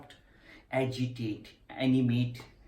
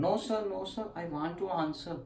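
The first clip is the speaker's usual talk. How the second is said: higher-pitched pupil's protest; acted insistent protest; 7 semitones higher than usual. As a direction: plead insistently in a raised pitch, mildly acted.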